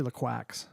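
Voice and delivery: "LaQuax" said luh-KWAKS, like a duck's quack; playful mispronounced brand name "LaQuax", rhyming with "tracks"; joke pronunciation. Shown as a demonstration. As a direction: say "LaQuax" with a "kwacks" ending. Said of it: "We say LaQuax".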